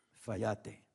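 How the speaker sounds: hushed, low and soft; soft voice of God. Acted. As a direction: say it gently like a quiet inner voice. Voz suavecita te dice. (voz suave y baja, casi en susurro)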